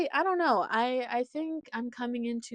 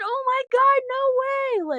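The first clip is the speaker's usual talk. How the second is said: shocked disbelief; 9 dB louder, slower than usual. Retold or imitated. Shocked at meeting her again.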